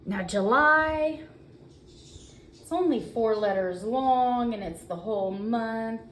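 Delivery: ordinary delivery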